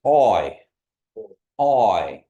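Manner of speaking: slow gliding oi; teacher models the oi diphthong; Kiowa oi. Shown as a demonstration. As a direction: glide oi from open o to i. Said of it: "it's a very Kiowa sound".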